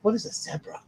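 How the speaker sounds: mock bewildered "What is a zebra?", WHAT up high; incredulous mimicking. Retelling his reaction to Prince Harry saying zebra, high and puzzled. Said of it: "I was like".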